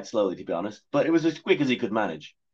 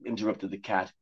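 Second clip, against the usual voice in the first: plain reading voice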